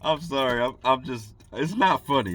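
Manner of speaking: apologizing through laughter, high and loud; laughing. Real, not acted. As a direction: fight down a laughing fit while talking. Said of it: "I'm just laughing at".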